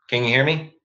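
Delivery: normal talking voice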